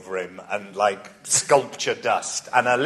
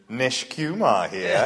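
slow self-important text; much slower than usual. Retold or imitated. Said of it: "I text him".

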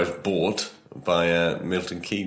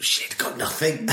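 breathy whisper, giving up; 7 semitones higher, slower than usual. Real, not acted. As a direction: whisper it like you're stumped. Stuck for ideas, gives up.

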